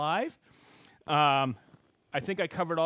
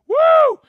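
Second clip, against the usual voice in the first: high, loud "woo"; excited whoop; 19 dB louder than usual. Real, not acted. Host cheering the idea of a European tour, excited whoop. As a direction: let out a big high whoop.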